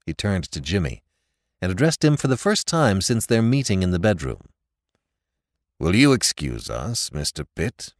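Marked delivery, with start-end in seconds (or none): 5.8-7.9 put-on lower, curt older man's voice; cold dismissal of the young man; a father sends the suitor away, lower and curt; stern father. A narrator's reading. McEachern's voice in narration.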